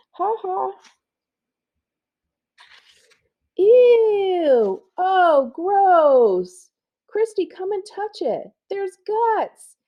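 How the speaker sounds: put-on high, loud squealing kids; disgusted kids. Schoolkids squeal at something gross in high voices.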